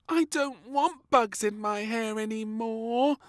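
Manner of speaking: high, slow, loud whine; moaning. A narrator's reading. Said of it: "moaned little Princess".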